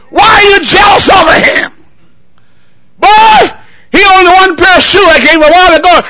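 fiery pulpit rebuke; preaching at full force. Acted. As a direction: rebuke the jealous son from the pulpit. Father scolding the grumbling son.

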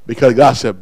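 ordinary delivery

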